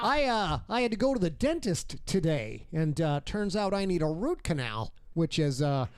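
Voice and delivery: lightly played late-night monologue; a hint of a talk-show host setting up the bit. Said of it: "the Johnny Carson show".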